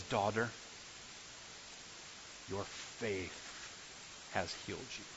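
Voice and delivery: soft, hushed, tender voice of Jesus; tender quiet Jesus. Acted. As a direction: speak gently to the daughter, barely above a whisper. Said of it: "he says".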